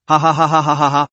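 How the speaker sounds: hearty read-out laugh, ha ha ha; bursting into shared laughter; group laughter. A narrator's reading. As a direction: laugh it out together in a run of ha's. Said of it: "Several people laughed out at the same time".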